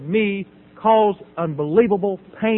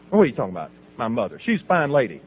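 low, guarded mock indignation; defensive challenge; 7 semitones lower, faster than usual. Son defending mother from criticism, low and defensive. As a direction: warn them off in a low voice.

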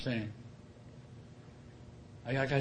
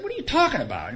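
indignant retort; 8 semitones higher, 3 dB louder than usual. Retold or imitated. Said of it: "It made me mad".

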